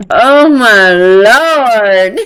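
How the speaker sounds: a long, loud, drawn-out groan of disbelief; exasperated. Real, not acted. Reacting to the messages with loud, slow disbelief. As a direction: stretch it out, loud and fed up.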